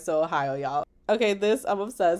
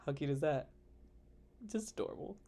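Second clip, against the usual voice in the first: softer, lower repeat; soft echo; 3 semitones lower, 12 dB quieter, faster than usual. Shown as a demonstration. Bride cooing over niece photo, softly echoing herself. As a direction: murmur it back to yourself. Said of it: "repeated line".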